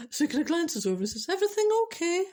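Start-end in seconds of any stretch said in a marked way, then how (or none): 1.3-2.2 nervous squeaky high pitch; timid high voice. Retold or imitated. Husband nervously asking his wife, high timid voice. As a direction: ask it in a small high-pitched voice. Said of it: "I don't know if his voice had gone like that".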